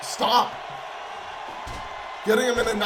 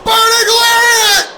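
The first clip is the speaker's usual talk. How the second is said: much higher, much louder shout; excited shout; 11 semitones higher, 10 dB louder than usual. Real, not acted. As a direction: yell the move name to the rafters. Commentator calls big move.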